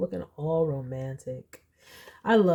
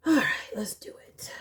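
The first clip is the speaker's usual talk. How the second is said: whispering; 2 semitones higher, faster than usual. Real, not acted. Whispered before drawing.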